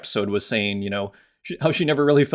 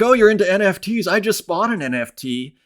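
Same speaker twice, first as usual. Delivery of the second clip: higher-pitched, quicker burst of eager news; imitating a friend's thrilled outburst; excited friend; 9 semitones higher than usual. Architect friend shares his first NFT purchase, excitedly. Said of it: "he was so excited".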